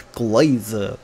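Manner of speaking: playful Texan Glaza; Texan accent. Shown as a demonstration. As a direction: lean into the Texan drawl on Glaza. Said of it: "I just said that in, like, a Texan accent".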